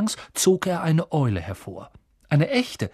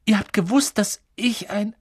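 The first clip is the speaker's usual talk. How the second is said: ungläubig; 7 semitones higher than usual. A narrator's reading. Harry ungläubig.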